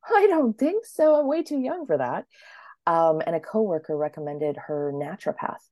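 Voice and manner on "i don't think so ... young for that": high, loud indignant refusal; defiant retort. Retold or imitated. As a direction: push back high and sharp. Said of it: "And I was like".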